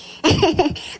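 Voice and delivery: quick amused laugh at her own joke; a brief real chuckle; short laugh. Real, not acted. Laughs at own joking example.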